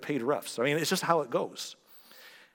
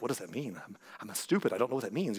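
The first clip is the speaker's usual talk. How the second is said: mock confused muttering; 5 semitones lower, 7 dB quieter than usual.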